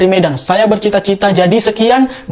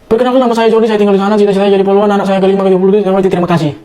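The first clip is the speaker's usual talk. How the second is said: fast, tumbling self-introduction; rushed intro; much faster than usual. Acted. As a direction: rattle it off at x1.8 in one breath. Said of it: "Entah apapun kau bilang, yang cepatan".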